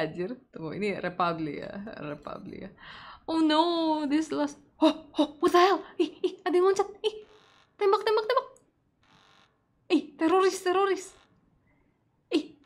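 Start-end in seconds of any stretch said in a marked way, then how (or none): 3.3-12.6 high, loud panicked shrieks. Real, not acted. Streamer panicking at a terrorist in game, high shrieks. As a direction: shriek in alarm as the attack unfolds.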